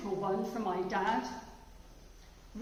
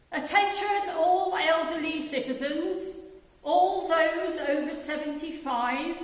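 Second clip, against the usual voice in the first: higher, louder announcer call; pompous announcement; 6 semitones higher, 7 dB louder than usual. Acted. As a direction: address the nation like an official broadcast. Poem as BBC announcement.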